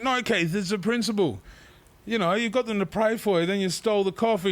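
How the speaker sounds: high-pitched mock indignation; exasperated scolding. Refusing Keith's coffee on principle, high exasperated scolding. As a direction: lecture a mate in high protest.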